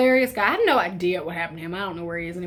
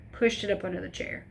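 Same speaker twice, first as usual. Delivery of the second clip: ordinary delivery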